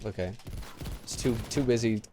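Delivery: ordinary talk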